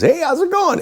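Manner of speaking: very high, chirpy hello; imitated breezy boss. Station owner phoning the host, imitated high breezy greeting. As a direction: put on an overly friendly greeting.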